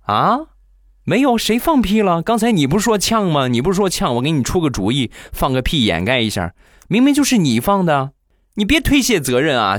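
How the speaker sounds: high, mock-wronged protest; aggrieved denial. Boyfriend denies the fart to his girlfriend, mock aggrieved and high. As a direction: play the falsely accused innocent. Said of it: "她男朋友一脸委屈的看着大苹果".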